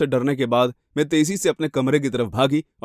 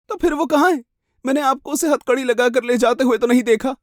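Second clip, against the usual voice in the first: high, puzzled challenge; anxious victim; 10 semitones higher than usual. Acted. Victim doubting the policeman's news, high and confused. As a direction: question him urgently, voice pitched up.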